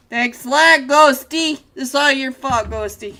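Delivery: loud, high mock blame; hammed-up outrage at a friend; mock accusation. Caster blaming friend Ghosty, loud hammed-up outrage.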